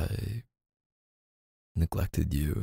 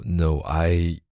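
ordinary delivery

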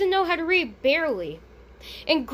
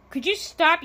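usual speaking voice

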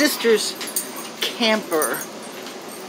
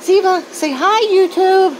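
much higher coaxing voice; pet voice; 12 semitones higher, 5 dB louder than usual. Real, not acted. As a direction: coax the dog to say hi. Getting the dog to greet viewers.

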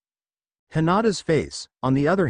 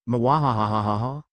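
evil cackle. Acted. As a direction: cackle like a villain. Villain laugh after threat.

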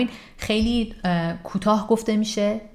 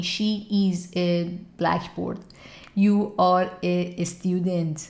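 very slow spelled-out words; much slower than usual. Shown as a demonstration. Teacher showing the wrong slow way. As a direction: say each word apart, very slowly. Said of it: "اینجوری سعی نکن بگی".

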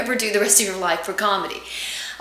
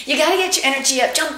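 ordinary talk, nothing marked

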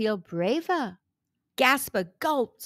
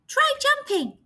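bright suggestion; 13 semitones higher than usual. Acted. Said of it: "she suggested".

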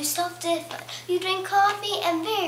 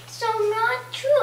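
put-on slow, higher, drawn-out Californian valley girl; 7 semitones higher, slower than usual. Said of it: "I'm gonna be Californian".

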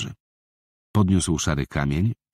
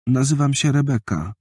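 put-on higher small-girl voice; a timid six-year-old girl; little girl's voice; 9 semitones higher than usual. Girl answers boy. (wysoki głos małej dziewczynki)

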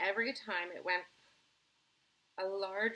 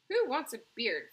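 loud, higher indignant question; Veruca Salt; 4 semitones higher, 9 dB louder than usual. A narrator's reading. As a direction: protest loudly, pitched up. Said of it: "cried Veruca Salt".

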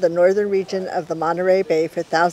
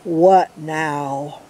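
grumpy lower voice; grumbled; 3 semitones lower than usual. Acted.

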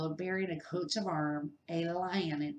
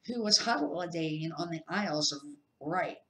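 plain reading voice

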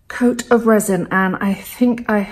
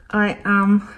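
talking around a paintbrush in mouth; mouth full. Real, not acted. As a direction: speak with a brush between teeth. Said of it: "I've got a paintbrush in my mouth".

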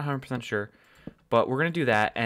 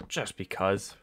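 ordinary talk, nothing marked

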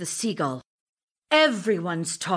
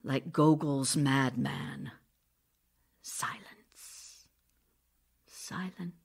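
hushed whisper; 7 semitones lower, 9 dB quieter than usual. Acted. Fade into a whisper, lower. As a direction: drop to a breathy whisper, lower. Masha falls silent after confessing.

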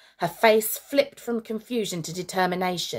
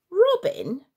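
surprised; 11 semitones higher than usual. A narrator's reading. Surprised at friend jumping in.

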